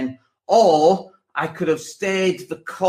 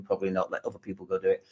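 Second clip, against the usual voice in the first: muttered thought; 7 semitones lower, 11 dB quieter, much faster than usual. Retold or imitated. Inner voice deciding against expedition.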